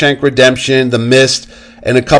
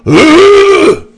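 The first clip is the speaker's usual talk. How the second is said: very high, loud strangled scream; hammed-up Punisher yell; mock Punisher roar; Punisher grunt; 4 dB louder than usual. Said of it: "listen to him go".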